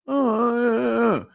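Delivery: high, slow, stalling uh uh uh; mock stammering. Mocking podcast guests who stall for an answer. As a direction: play a guest caught out and stalling. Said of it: "and they go".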